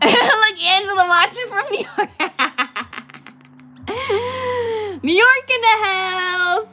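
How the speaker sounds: high, loud laughing call-out; shrieking with laughter; laughing shout. Real, not acted. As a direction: crack up while hyping the viewer. Laughing at a New York viewer.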